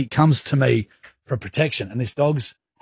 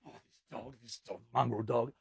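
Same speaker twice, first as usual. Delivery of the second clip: very quiet cursing to oneself; pacing grumble; 16 dB quieter than usual. Retold or imitated. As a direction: act out muttering about the dog under your breath. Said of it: "I was pacing like this, going".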